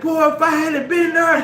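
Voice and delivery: high, loud bragging yell; boasting shout. Retold or imitated. Friends boasting on the phone.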